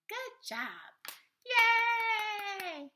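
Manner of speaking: high, loud, drawn-out cheer; big high-pitched hooray; celebratory cheer. Real, not acted. Cheers the child finishing the words.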